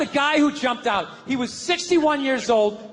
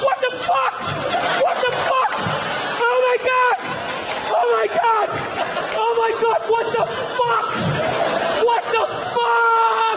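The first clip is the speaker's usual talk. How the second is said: shocked screaming; 12 semitones higher than usual. Acted. Pilot screaming after his passenger jumps out.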